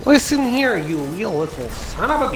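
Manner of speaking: high, drawn-out mock rant; mock scolding. Mock scolding of another player.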